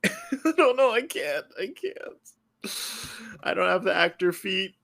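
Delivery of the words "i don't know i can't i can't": laughing. Real, not acted. Laughing embarrassed at accent request.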